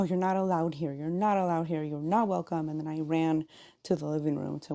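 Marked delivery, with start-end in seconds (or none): none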